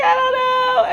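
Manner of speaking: high, loud sobbing yell; crying shout. Retold or imitated. Stranded daughter wails to mom, crying and shouting high. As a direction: wail it through tears.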